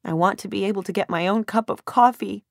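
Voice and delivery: high, tearful narrated whimper; pitiful, crying complaint. A narrator's reading. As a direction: whine it out on the edge of tears. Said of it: "I whimper".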